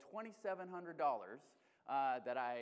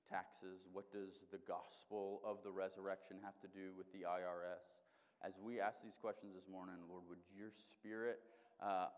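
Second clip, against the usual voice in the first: prayer; 6 semitones lower, 4 dB quieter than usual. Acted. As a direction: pray reverently and quietly to God. Pastor prays before the sermon.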